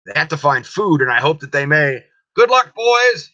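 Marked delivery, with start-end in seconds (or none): 2.3-3.3 put-on high, loud farewell call to the boys; cried out. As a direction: call them off loudly and high. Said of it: "he cried".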